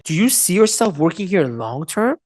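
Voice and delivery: judging, sceptical question with rising end; bad tone. Shown as a demonstration. Coach repeating bad tonality for trainees, judging. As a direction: make them feel judged. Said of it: "when you have bad tone".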